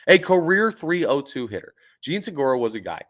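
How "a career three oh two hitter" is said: higher and louder, punched; louder emphatic third take. Shown as a demonstration. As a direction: hammer it home a final time. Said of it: "One more time for emphasis".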